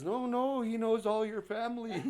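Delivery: husband insisting to his wife, excited; eager insisting. Retold or imitated. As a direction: insist the drifter is family.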